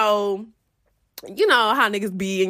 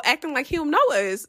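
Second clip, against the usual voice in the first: usual speaking voice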